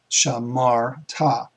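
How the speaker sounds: "shamar ta." said slowly, syllable by syllable; teacher sounding out "shamar ta." slowly; slow Hebrew demo. Shown as a demonstration. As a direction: spell out "shamar ta." slowly for students. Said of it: "what we have is shamar".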